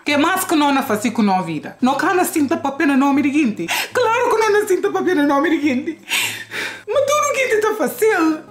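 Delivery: mock-exasperated, rapid high protest; comic rant. Comic rant switching into another language.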